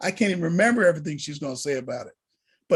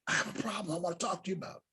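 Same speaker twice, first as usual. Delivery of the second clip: retold hushed voice; 13 dB quieter, much faster than usual. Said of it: "Why are we whispering?".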